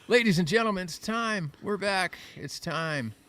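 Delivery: loud, high showman opening; announcer voice. Acted. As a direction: hype up the crowd. Host announces show start.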